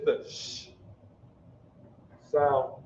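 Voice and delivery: SH as a long vowelless hiss; soft sh sound. Shown as a demonstration. Teacher giving the soft sound of sha, long voiceless SH. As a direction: hold "sh" on breath alone, then "sound". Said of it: "what do you think would be the soft sound".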